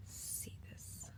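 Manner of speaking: barely audible whisper; hushed awe. Real, not acted. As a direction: let it fade to a whisper. Falls silent to show view.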